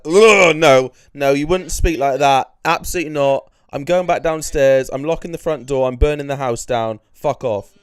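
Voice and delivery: mock-indignant rant at the imitator; loud refusal. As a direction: shut it down hard, disgusted. Rejecting the fake mother loudly.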